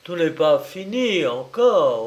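harsh retort. A narrator's reading. Admiral pushing the widow toward another room, speaking harshly. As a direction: cut in harshly, refusing to let it end. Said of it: "reprit durement l'amiral".

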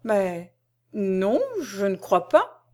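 slow, hesitant denial; mildly dazed and unsure; bewildered stammer. A narrator's reading. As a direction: falter slowly, as if doubting yourself. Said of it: "balbutia Parabère tout effaré".

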